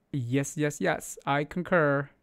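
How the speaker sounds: ordinary talk, nothing marked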